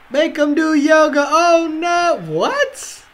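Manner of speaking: high-pitched shouts rising to a squeaked "what"; excited shock. Real, not acted. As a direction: react in rising, high disbelief. Reacting to a big play live.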